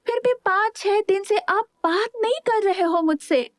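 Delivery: put-on high, sulky complaining girl; a hurt young sister's high voice; sulking girl. A narrator's reading. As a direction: complain to him in a sulk. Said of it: "नाराजगी जाहिर करते हुए कहा".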